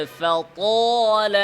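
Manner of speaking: slow chanted tajweed reading of فطول; drawn-out Quranic recitation. Shown as a demonstration. Teacher reciting for students to copy, chanted slowly. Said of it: "বলেন".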